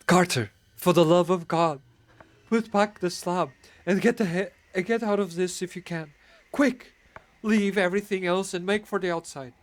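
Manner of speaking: high, loud, urgent pleading; wild consternation. Acted. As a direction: beg him to flee, frantic. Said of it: "in a pitch of wilder consternation".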